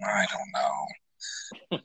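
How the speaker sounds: distant and off mic, slow and low; far from mic. Real, not acted. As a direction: sound far away from the mic. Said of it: "You sound like you're far away right now".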